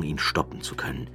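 ordinary delivery

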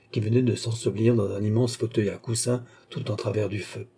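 ordinary delivery, nothing marked

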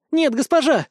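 high, alarmed mild shout; crying out. A narrator's reading. Girls try to stop their mistress, crying out. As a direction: cry out in high-pitched protest. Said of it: "закричали девчонки".

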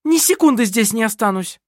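high, indignant outburst; angry refusal. A narrator's reading. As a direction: declare it hotly as you storm out. Kerstin jumps up to storm out.

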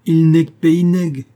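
high, loud, slow creole; drawn-out scoff in Guianese creole; creole accent. Acted. Cayenne creole mocking Janvier, loud creole accent. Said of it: "dit le créole de Cayenne".

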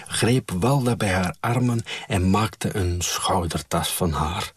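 ordinary delivery

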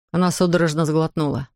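ordinary delivery, nothing marked

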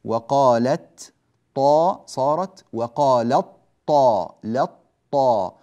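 slow and loud, stretching "طا" in "وقالت طا"; slow, loud teacher drill of "وقالت طا"; drilled assimilation. Shown as a demonstration. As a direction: hammer out "لط طا" as a doubled emphatic ta. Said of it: "فنلغي الحرف الأول تمامًا".

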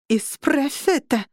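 slow, pressed words through gritted teeth. A narrator's reading. Sofie demanding George fix it, gritted teeth. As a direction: squeeze it out through clenched teeth. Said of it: "выдавила Софи сквозь зубы".

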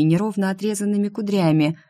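ordinary delivery

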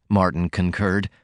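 usual speaking voice

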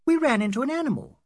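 high, loud, quick female voice; insistent Adela. Acted. Adela telling the men what caused the accident, insistent. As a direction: blurt it out high and fast.

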